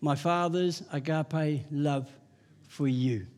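low, soft and slow, tender; soft gentle voice. Retold or imitated. The Lord answering the preacher, soft and gentle. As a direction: hush it like a gentle inner voice. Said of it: "a very soft little gentle voice".

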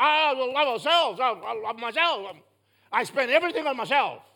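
loud, hammed-up self-absorbed boasting; mock self-love. Mocks self-love in congregation's voice.

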